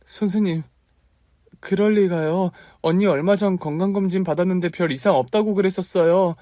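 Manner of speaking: high, stunned protest to the doctor; shocked disbelief. Acted. Aunt pleads with the doctor in high shocked disbelief. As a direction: refuse to believe the news.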